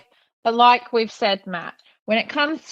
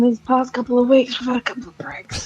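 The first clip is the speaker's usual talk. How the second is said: whispered aside; 2 semitones higher, faster than usual. Pretend. Joke punchline aside.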